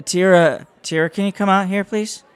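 calling out. Acted. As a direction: call her name out over the crowd.

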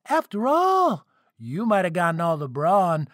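high, cocky son's taunt; gleeful gloating. A narrator's reading. Son taunting his father after shooting fire, gleeful. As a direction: crow it gleefully, pitched well up.

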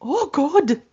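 high-pitched mock dismay; dismayed mom. Mom hearing her little girl cuss, dismayed. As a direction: groan it as a mortified mother. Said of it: "the mom goes".

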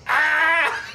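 high, loud scream with laughter; imitated pained scream. Frank sliding down a dry slide, imitated scream. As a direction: scream like you're scraping down dry plastic. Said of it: "you just hear Frank going".

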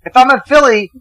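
high, fired-up rant with pitch jumping well up; defending Boston to the hosts, fired up and high. Real, not acted. To the hosts about his hometown, a fired-up high rant.

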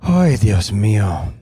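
slow, drawn-out lament; mock lament. Real, not acted. Husband despairing over the nose drama, slow mock lament. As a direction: sigh it out slowly in Spanish.

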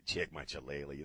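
soft, low mock Mexican accent. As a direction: repeat it quietly to yourself in a mock Mexican accent. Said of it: "with a Mexican accent".

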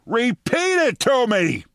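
high, slow, mock-screamed order; demanding it back, pitched high. Deputy mayor screaming at the mayor, high and slow. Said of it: "screamed at Rudy Giuliani".

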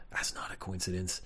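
whispered aside, like sharing a secret; hushed conspiracy whisper; conspiratorial whisper. Real, not acted. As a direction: lean in and whisper it. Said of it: "conspiratorial aside whispered".

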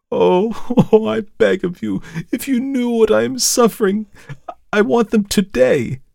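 high, loud sobbing plea; tearful begging. A narrator's reading. Cousin begs the narrator while weeping, high loud plea. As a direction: plead through tears. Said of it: "She wept and sobbed".

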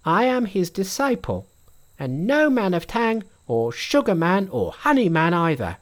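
high, bright monkey king voice. A narrator's reading.

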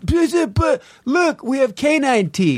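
very high, put-on protesting voice; high-pitched caricature of an objector; mock meat-eating advocate. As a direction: argue the cause in a squeaky mock voice. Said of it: "someone will take up the cause of".

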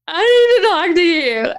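high, loud mock wail; tearful outburst. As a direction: blurt it out like a hammed-up sob. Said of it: "busted out crying".

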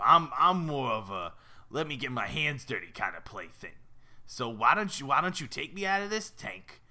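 wheeler-dealer fish with a New York rasp; raspy New Yorker. Acted. Fish talking the girl into playing, raspy New York voice. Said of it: "They all sound like raspy New Yorkers".

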